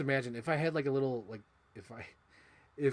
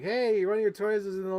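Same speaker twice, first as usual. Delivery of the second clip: mock yell from the house; mom yelling; 7 semitones higher, 8 dB louder than usual. Mom calling about a toy in the yard, mock yell. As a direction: call out like mom from the porch. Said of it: "I heard my mom yell".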